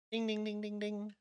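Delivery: high, soft imitation of a bell; correct-answer bell. Host tells the contestant he got it right, high imitated bell. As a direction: chime ding-ding like a game-show bell.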